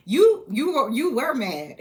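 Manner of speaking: loud, high burst of emphasis; emphatic. Real, not acted. Vlogger hammering the point to viewers, loud and high. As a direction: punch it out loud at the listener. Hyperbolic cheating husband story.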